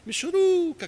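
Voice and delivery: high-pitched puny little voice, imitated; voz michuruca. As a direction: mimic a pathetic, feeble caller in a high pitch. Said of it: "com aquela voz assim". (vozinha aguda e michuruca, imitada)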